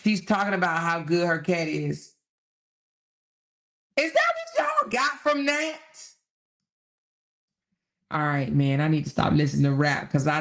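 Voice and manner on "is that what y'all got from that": high-pitched disbelieving question; taken aback, voice pitched up; incredulous. Real, not acted. Disbelief at others' reading of lyrics.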